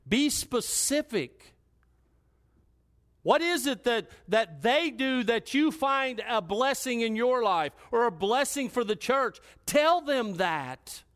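high, forceful exhortation building in pitch; impassioned preaching. Real, not acted. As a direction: drive each phrase home to the congregation.

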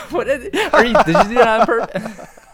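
laughing through the words; cracking up mid-question. Real, not acted. Laughing at friend's teasing.

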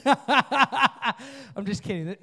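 real laugh, slowly breaking through the words. Real, not acted. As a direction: laugh out loud at yourself. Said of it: "don't you dare make fun of me laughing".

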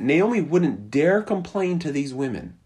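normal talking voice

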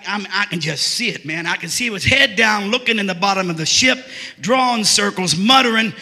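ordinary talk, nothing marked

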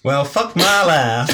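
slow, high southern US drawl; southern accent attempt. Reader retries Alicia's line in a southern accent. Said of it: "No, I really can't".

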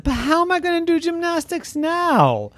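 very high llama whine; dismayed wail. Acted. Llama fearing for gymnastics, dismayed wail.

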